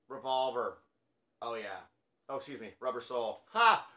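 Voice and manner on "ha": sharp high bark of a laugh; a real laugh at his own mistake; loud laugh. Real, not acted. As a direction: burst out laughing at the slip. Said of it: "I said the wrong title".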